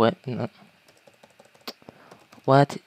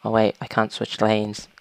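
ordinary talk, nothing marked

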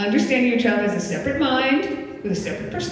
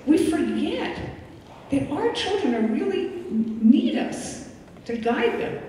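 ordinary talk

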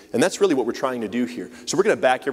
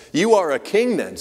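higher, louder challenge as Pilate; Pilate's challenge; 7 semitones higher, 5 dB louder than usual. A narrator's reading. Narrator voicing Pilate, challenging and raised. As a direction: voice Pilate pressing the question. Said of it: "said Pilate".

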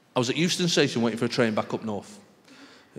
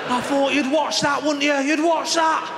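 loud, high-pitched appeal to the crowd; slow, high shout of incredulous glee; excited shouting; 12 semitones higher, 4 dB louder, slower than usual. Acted.

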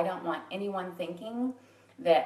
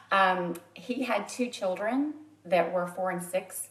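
normal talking voice

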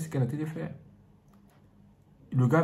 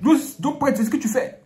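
high, loud accusing shout; angry husband; 12 semitones higher, 6 dB louder than usual. Acted. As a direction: confront him furiously. Husband confronts priest, acted quarrel.

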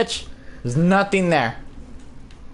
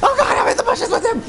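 high fast panicked rush; 13 semitones higher, faster than usual. Real, not acted. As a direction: babble in fright. Hiding near monster.

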